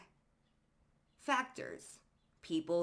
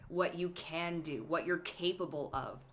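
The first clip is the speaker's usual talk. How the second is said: ordinary delivery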